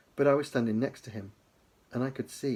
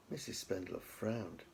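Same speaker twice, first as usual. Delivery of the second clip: usual speaking voice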